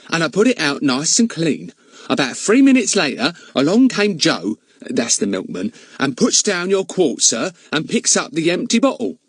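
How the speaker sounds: high, chatty housekeeper protesting. Acted. Charwoman contradicting the village policeman, high and eager. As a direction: pitch her up like a bustling charwoman.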